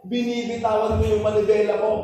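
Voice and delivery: ordinary delivery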